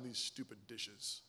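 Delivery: very low, quiet resentful grumble; grumbling under breath. Acted. Husband doing chores he resents, grumbling under his breath. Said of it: "grumbling under my breath".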